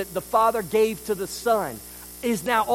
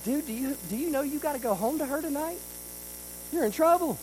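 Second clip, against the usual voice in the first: teasing aside to Adam; 7 semitones higher, 3 dB quieter, faster than usual. Retold or imitated. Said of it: "I'm like".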